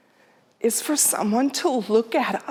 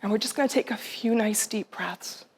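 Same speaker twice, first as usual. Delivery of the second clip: guided meditation; 4 dB quieter than usual. Acted. Speaker guiding the audience, softly meditative. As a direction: lead them gently into breathing.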